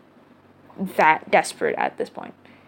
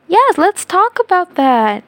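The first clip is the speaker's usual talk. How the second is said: excited; 10 semitones higher, 5 dB louder, slower than usual. Acted. Podcast host hamming up segue.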